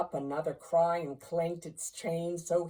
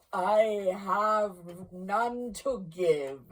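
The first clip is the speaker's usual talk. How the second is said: slow, stretched-out ghostly reply; solemn ghost; 3 semitones higher, 5 dB louder, much slower than usual. Acted. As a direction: intone it heavily, drawing out each word. Ghost refuses Scrooge's plea.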